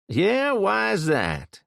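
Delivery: mocking tough kid. A narrator's reading. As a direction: drawl the question out to mock him. Kid mocks Jason.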